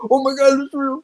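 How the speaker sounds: very high squealed excitement; mock amazement. Friend pretending to be amazed at the recording, mock squeal.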